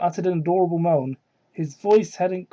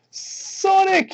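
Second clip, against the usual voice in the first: mock yell; 13 semitones higher than usual. Character yells lover's name.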